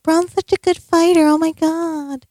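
high, gushing mock praise; mock the fans who adore him. Mocking the fans with high gushing praise.

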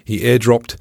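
plain reading voice

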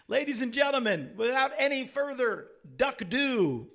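grand emcee build-up to the name; show announcer. Real, not acted. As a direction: announce the guest like a ringside MC. Introduces guest as announcer.

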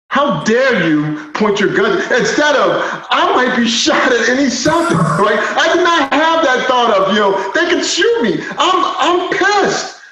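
high-pitched indignant outburst; worked-up, incredulous anger in the retelling; pissed off. Retold or imitated. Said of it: "I'm fucking pissed off".

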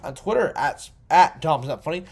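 usual speaking voice

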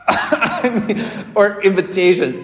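amused laugh breaking into the words. Real, not acted. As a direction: priest to congregation, laughing at the posters.

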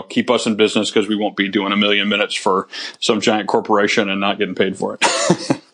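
ordinary talk

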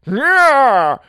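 high loud yelp of falling; grunt. A narrator's reading. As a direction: cry out as you topple. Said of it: "the man grunted as he fell".

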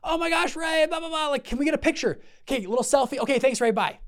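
high, breathless fan gush; mock a starstruck fan rushing the celebrity; gushing fanboy. Speaker mocks fans mobbing Ray Dalio, in a high gushing voice. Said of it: "all of them ran up like fanboys".

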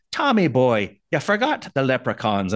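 playful light Irish brogue; Irish accent. Acted. Harry teasing Tom about leprechauns, light Irish accent. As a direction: tease with a little Irish lilt. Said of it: "threw in a little bit more Irish accent".

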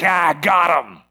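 drawn-out, slow gloat; gloating enemy. Acted. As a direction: savour a successful trick. The enemy gloats over his catch.

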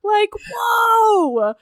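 high, loud, stretched-out squeal of awe; thrilled shout of wonder. Retold or imitated. Heroine marvelling at the escape room, squealing with awe. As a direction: let "amazing" soar, slow and loud.

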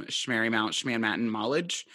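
"Schmarymount, Schmanmatton Mollage" with mock Schm- sounds swapped into each name; disguised college name. Real, not acted. Host refusing to name the old college, saying "Schmarymount, Schmanmatton Mollage" as mangled nonsense. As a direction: garble "Schmarymount, Schmanmatton Mollage" on purpose, keeping the rhythm of the real name. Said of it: "which I refuse to say the name of".

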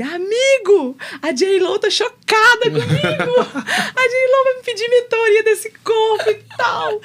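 hammed-up diva boast to a friend; excited boasting. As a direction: crow about it, cracking up. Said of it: "mandei pra ele".

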